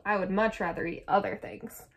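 usual speaking voice

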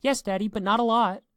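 put-on high, loud child voice; excited little girl. A narrator's reading. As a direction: pipe up eagerly like a small daughter. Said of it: "Her eyes were wide with excitement".

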